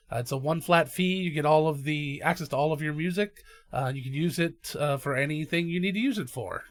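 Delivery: upbeat sponsor ad read. Acted. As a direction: pitch the sponsor to listeners. Reads the sponsor ad.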